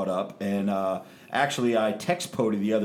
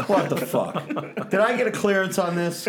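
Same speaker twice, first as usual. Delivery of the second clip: laughing; 8 semitones higher, 3 dB louder than usual. Real, not acted. Reacting laughing to segment.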